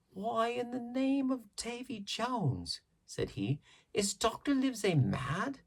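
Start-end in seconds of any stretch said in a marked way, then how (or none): 0.1-2.8 high, slow, thunderstruck seaman's voice; a sailor gaping at the news, drawn out high; astonished old sailor. A narrator's reading. 3.9-5.6 put-on astonished old sailor. A narrator's reading.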